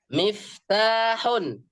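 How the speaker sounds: miftahun dragged very slowly for dictation. Shown as a demonstration. Teacher dictates a word to the class slowly.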